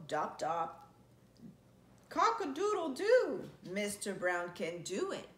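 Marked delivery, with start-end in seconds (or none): none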